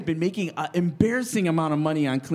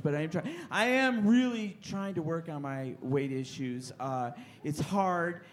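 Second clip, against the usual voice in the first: ordinary talk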